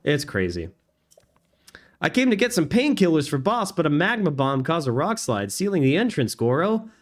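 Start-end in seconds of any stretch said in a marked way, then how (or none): 2.0-6.9 high-pitched, chatty character voice; perky game character. Acted. Errand-runner explaining a rock slide to Goro, high chatty voice.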